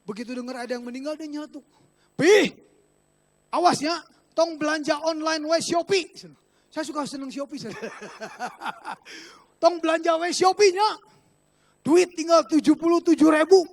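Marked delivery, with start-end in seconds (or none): 2.2-6.1 sudden loud, higher-pitched interjection; loud mock warning cutting in; Hani blurting out. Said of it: "tahu-tahu Hani nyeletuk". 9.6-13.6 loud, higher-pitched imitated scolding; mock nagging, raised voice; Hani blurting out. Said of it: "tahu-tahu Hani nyeletuk".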